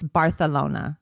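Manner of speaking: Barcelona with a th sound, Barthelona; Barcelona with a lisp. Shown as a demonstration. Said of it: "You have to lisp".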